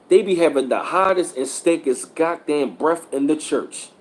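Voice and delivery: ordinary talk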